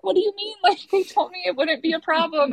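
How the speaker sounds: high, startled what do you mean; incredulous. Retold or imitated. Questioning the anesthesiologist, high and incredulous. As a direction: squeak out the disbelief, half laughing.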